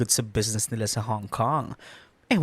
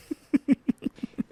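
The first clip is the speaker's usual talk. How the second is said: teasing giggle; 13 semitones higher than usual. Acted. Teasing friend laughs.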